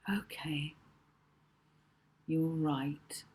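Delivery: a touch of glum resignation. A narrator's reading. Molly conceding to Rocky, a touch glum. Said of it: "said Molly glumly".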